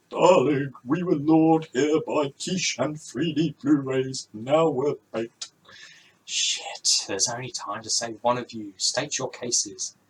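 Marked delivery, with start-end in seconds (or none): 0.1-5.5 pleading dad. Acted.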